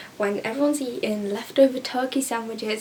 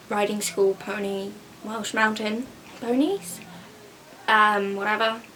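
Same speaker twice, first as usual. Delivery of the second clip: ordinary talk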